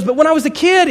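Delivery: high, loud objection; protesting. Acted. Client begins explaining childhood to therapist, protesting. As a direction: start pleading your case at high pitch.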